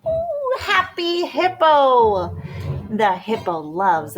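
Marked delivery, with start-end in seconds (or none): none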